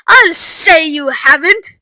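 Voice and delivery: loud, drawn-out retort; indignant cry. A narrator's reading. Missus Beauregard to Mister Wonka, indignant cry. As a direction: throw it back at him accusingly. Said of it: "cried Missus Beauregard".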